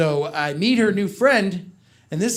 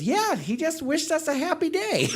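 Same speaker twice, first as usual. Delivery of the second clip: little daughter's voice; 9 semitones higher than usual. Retold or imitated. Five-year-old daughter voiced.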